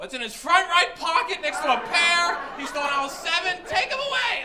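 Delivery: high, loud mock informant shout; eager snitching. Grandson giving up grandfather to guards, eager shouting. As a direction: blurt it all out at the top of your voice.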